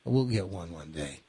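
soft, low consoling tone; hushed, gentle reassurance, retold; soothing grandpa. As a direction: comfort a disappointed little grandchild.

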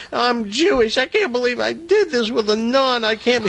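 high-pitched, hammed-up crying; mock sobbing confession. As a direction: wail it like a guilt-stricken sinner, played for laughs. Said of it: "He's sobbing".

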